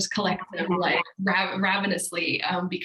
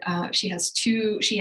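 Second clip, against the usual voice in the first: usual speaking voice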